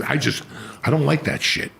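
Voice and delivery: ordinary delivery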